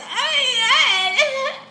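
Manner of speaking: long, loud squealed wail; high mock wail. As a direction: hold a very high hammed-up cry. Acting out her embarrassment.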